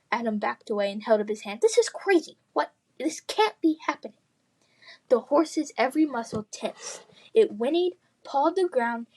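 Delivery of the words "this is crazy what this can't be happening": high, frightened disbelief; panicked. A narrator's reading. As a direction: babble it in panic. Adam panicking.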